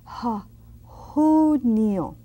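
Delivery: "Ha, junio", the J breathed like H. Shown as a demonstration. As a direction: start from "Ha" then "junio". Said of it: "That J is like an H sound".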